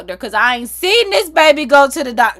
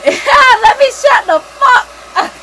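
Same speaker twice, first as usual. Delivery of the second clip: laughing at herself; 13 semitones higher, 11 dB louder, slower than usual. Real, not acted.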